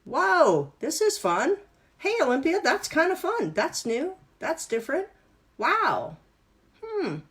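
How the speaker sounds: high, squealing wonder that swings up and down, with slow amazed questions; delighted brain. Retold or imitated. Instructor voicing the student's brain, delighted and amazed. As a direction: play the brain thrilled by something new, whooping at the start. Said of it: "Your brain's probably going".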